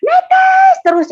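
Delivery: "Netes" held long, high and loud; drawn-out NETES. Retold or imitated. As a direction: stretch "Netes" like an endless stream. Repeats tears pouring, emphatic.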